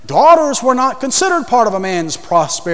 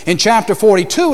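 ordinary delivery

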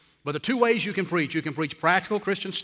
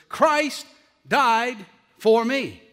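very slow, raised pitch, a long pause after each word; solemn last words; 4 semitones higher, 4 dB louder, much slower than usual. Retold or imitated. Preacher repeating a dying man's words for the congregation, slow solemn proclamation. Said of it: "he uttered these words".

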